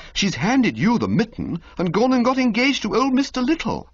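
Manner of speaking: put-on excited young toff. Bertie to Jeeves about the engagement, high excited toff voice. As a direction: land the news.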